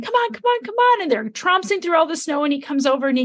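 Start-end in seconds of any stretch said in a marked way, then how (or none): 0.0-1.0 much higher, quick hurry-up calls; urging student. Retold or imitated. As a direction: pull someone along, eager. Student tugging fiancée through snow.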